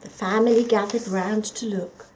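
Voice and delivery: plain reading voice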